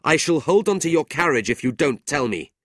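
high, loud warning; angry threat. A narrator's reading. Brother threatening to hold the carriage, angry. Nicholas threatens Sir Mulberry.

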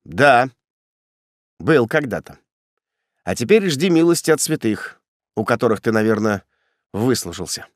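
understated menace, sinking at the end; ominous. A narrator's reading. Executioner refusing his brother's appeal, ominous tone. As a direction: answer ominously, letting it drop. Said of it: "ответил зловещим тоном".

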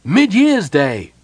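loud, high, drawn-out cheer; crowd's cry. A narrator's reading. Festival crowd answering the kender, crying out. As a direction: cry it out slowly as a whole crowd would. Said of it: "cried everyone".

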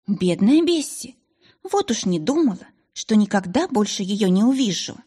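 usual speaking voice

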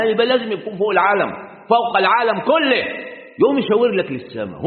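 normal talking voice

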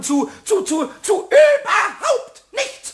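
stammering, rising to a slow high shout; building scold. Acted. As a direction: start muttering then explode into a shout.

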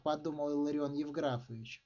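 usual speaking voice

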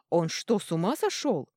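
loud, outraged king; angry shout. Acted. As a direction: keep roaring at him. King furious about the beating.

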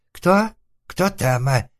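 high, startled voice of a man behind a door; испуганный голос. A narrator's reading. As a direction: squeal it in alarm. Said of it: "доносился из-за двери испуганный голос". (высокий испуганный голос)

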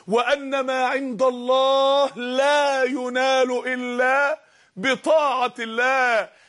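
very slow, drawn-out pulpit cry; slow, swelling preacher's call; fervent preaching. Acted. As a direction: stretch each word out like a sermon climax. Preacher quoting hadith.

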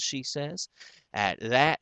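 plain reading voice

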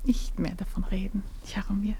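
soft hushed plea; whisper. Acted. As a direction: hush him gently under your breath. Cutting him off softly. (geflüsterte Bitte, leise)

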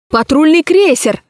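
high alarmed cry of «крейсер»; alarmed shout. A narrator's reading. Someone in the hall shouts the alarm. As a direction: call out the alarm from the crowd. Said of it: "крикнул кто-то в зале".

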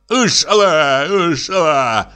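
high, drawn-out acted wail; dismayed cry. A narrator's reading. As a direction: cry out as your prey escapes. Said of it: "закричал Пётр Демьяныч".

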